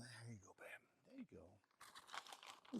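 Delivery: soft whisper to a calf. Real, not acted. Handing treats to a calf, gentle whisper. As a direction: barely breathe it so the calves stay calm.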